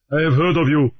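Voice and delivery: lightly voiced, cool pirate greeting; a hint of a pirate. Raoul the pirate greets Grief, lightly voiced and cool.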